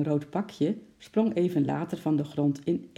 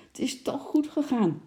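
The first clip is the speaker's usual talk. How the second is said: put-on very high child's exclamation; high-pitched little cry; calling out; 10 semitones higher than usual. A narrator's reading. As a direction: pipe it up in relief. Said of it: "riep Lotje".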